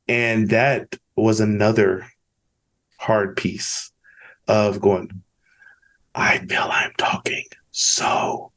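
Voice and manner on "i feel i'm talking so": whispered, as if afraid to be heard; hushed self-quote. Retold or imitated. Speaker recalls his first slow talks, whispering. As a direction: breathe it out under his voice. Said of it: "It's so quiet".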